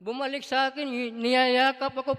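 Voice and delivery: ordinary talk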